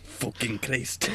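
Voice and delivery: slow, quiet Scottish curse; Scottish accent. Acted. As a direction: drag out a Scottish swear. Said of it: "the only two words I can say in Scottish".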